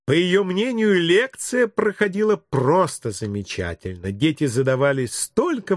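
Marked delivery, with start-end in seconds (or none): none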